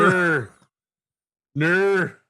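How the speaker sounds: high, drawn-out "Ner" for "no"; mimicked Australian no. Mocking the Australian clip, high mimicked "Ner". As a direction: bend the O of "Ner" into a long "err". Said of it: "Can you not make an O sound?".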